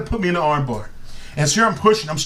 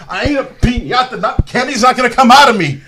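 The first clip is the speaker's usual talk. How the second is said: laughing protest; 8 semitones higher, 9 dB louder than usual. Retold or imitated. Retold protest while being beaten.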